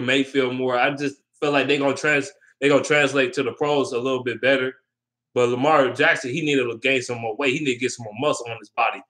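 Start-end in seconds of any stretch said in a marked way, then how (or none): none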